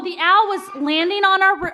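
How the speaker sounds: normal talking voice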